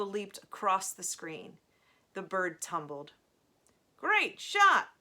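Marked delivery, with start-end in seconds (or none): 4.0-4.9 high, loud game announcer voice; automated voice. A narrator's reading. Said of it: "said the automated voice".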